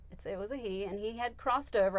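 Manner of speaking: ordinary talk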